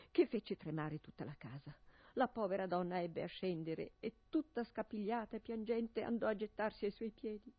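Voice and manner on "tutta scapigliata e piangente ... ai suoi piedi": usual speaking voice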